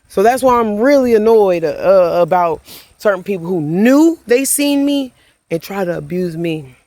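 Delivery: usual speaking voice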